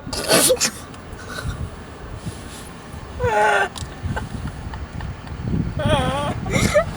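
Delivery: long, high wheezing laugh; loud laughing fit. Real, not acted. Trying to start the humbled story, helpless laughing. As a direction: lose it completely, mostly breath with squeals. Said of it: "Why are you so loud?".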